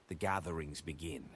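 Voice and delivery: ordinary delivery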